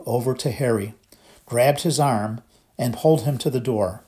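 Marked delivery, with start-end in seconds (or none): none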